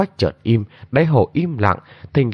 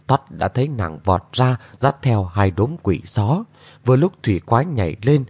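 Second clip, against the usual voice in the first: usual speaking voice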